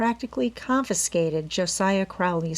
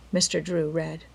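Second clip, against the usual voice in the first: ordinary delivery, nothing marked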